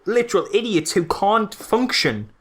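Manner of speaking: ordinary talk, nothing marked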